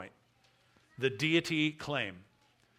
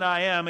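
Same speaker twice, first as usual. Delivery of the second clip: "I am" said louder, with weight; emphatic; 3 semitones higher, 7 dB louder than usual. Shown as a demonstration. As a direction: lean firmly on "I am". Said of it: "he says this with emphasis".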